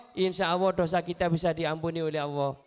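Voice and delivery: ordinary talk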